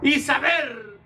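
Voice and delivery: high, slow, shouted preaching; fervent shouted sermon; preaching at full force. Acted. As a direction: build to a shouted climax of thanks. Preaching the man's homecoming.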